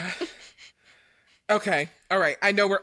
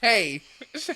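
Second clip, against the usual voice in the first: plain reading voice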